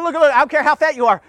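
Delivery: normal talking voice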